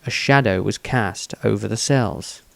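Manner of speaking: ordinary delivery, nothing marked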